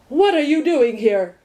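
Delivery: a hint of a gruff watchdog; growled. A narrator's reading. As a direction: challenge the stranger with a mild growl. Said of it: "growled the watchdog".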